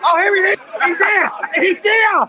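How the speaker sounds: high, loud excited calling out; excited shout as friend appears. Real, not acted. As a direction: greet him like a hero's arrival.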